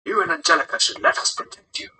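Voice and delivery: put-on whispery old man's voice; scolding old man. Old leader scolds Massimo for fighting, whispery old voice.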